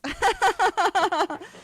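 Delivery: a bright high burst of laughter; high giggle. Real, not acted. Amused by her matching mic, high giggle. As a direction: giggle high and loud at your own joke.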